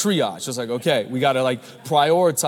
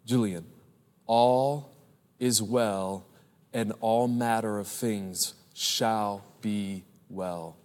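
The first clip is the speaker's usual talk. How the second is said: soft, slow and gentle, a hushed blessing; quiet tender voice of Jesus; 7 semitones lower, 7 dB quieter, slower than usual. Retold or imitated. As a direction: lay each word down slowly, like a comforting promise. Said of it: "Jesus comes to her and Jesus says".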